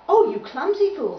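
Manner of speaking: high, loud scolding; snappish. Acted. Violins scolding clumsy Tubby, snappish. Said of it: "snapped the violins".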